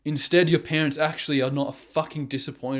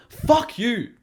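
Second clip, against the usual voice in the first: higher, sharper fuck you thrown out in anger; angry outburst; 7 semitones higher, 4 dB louder than usual. Real, not acted. Ranting at lazy viewers, an angry higher-pitched fuck you. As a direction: spit the words at them, genuinely angry. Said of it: "getting angry".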